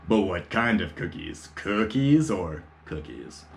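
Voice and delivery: slow knowing tone, leaning on the second cookies; suggestive hint. Retold or imitated. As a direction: drag it out with a wink about weed. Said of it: "message me going".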